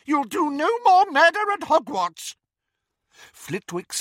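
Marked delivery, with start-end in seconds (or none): 0.0-2.3 high, squeaky shout; squeaky Flitwick. Acted. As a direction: shout it shrilly, high and loud. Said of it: "squealed Flitwick".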